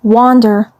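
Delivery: plain reading voice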